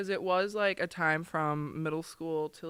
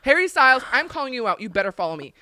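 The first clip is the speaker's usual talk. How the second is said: demanding call-out; 6 semitones higher, 10 dB louder, faster than usual. Retold or imitated. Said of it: "and I still didn't get a follow".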